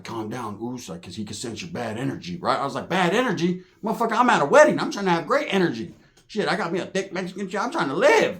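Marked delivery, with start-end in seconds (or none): 0.0-2.4 low, soft voice of a cautioning friend; soft, low-key warning; hushed calming. Retold or imitated. Homie quietly calms him down. 2.9-8.4 higher, animated comeback; worked-up mock indignation; incredulous protest. He protests the homie's warning.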